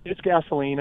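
ordinary talk, nothing marked

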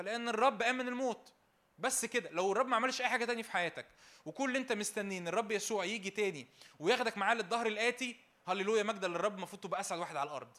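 loud, fast, rising sermon delivery; full-force preaching. Acted. Preacher rousing a joyless congregation, loud fervent preaching. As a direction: build to a fervent preacher's cry.

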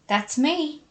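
high, chirped exclamation; chirpy. A narrator's reading. May spotting her turn-off on the road sign, chirpy. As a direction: chirp it with a lift in pitch. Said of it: "she chirped".

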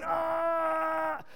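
high, long cry of pain; pained yell. Retold or imitated. Preacher imitating Superman's death cry, pained yell. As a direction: cry out like a dying hero. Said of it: "he yells out in pain".

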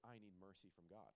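retelling a convict's tearful plea to the pastor, softly imitated; quiet crying plea. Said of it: "he started crying".

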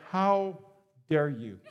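low, soft, cold "how dare you"; mock indignant. Pastor imitating his wife's morning accusation, low mock indignation. Wife angry after a dream.

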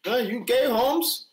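mock heckle as a Mexico fan, questions lifting high; playful imitation of a heckling Mexican supporter; imitating Mexico fans. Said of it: "the Mexico fans booed".

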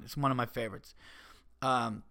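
ordinary delivery

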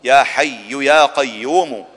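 ordinary talk, nothing marked

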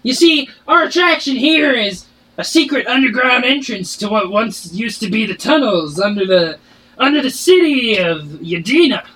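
a barkeep shouting from behind the bar; dwarf barkeep. Acted. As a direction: bark orders at the kitchen staff. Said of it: "His voice does not match his description".

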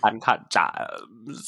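slow creaky drawn-out fry; vocal fry. Retold or imitated. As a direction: stretch it with a creak. Repeating the catchphrase.

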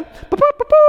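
high mock trumpet toots; trumpet blasts. Preacher imitating Israel's trumpets for the congregation, high toots. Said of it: "cuando tocaron la primera trompeta".

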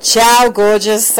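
loud, drawn-out flirty call; admirer's lingering parting shout; calling after her. A narrator's reading. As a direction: call it out slowly to someone walking off. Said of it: "Al called after her".

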